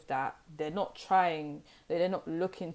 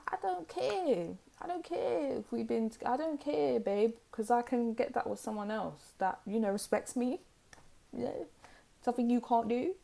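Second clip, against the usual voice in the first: mock dismissive retort; 7 semitones higher than usual. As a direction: brush off the pleading ex in a raised pitch. Acting out a dismissive reply to ex.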